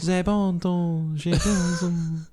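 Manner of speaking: slow, high hammed-up French; high, drawn-out mock-French accent. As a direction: ham up a fake French phrase, slowly.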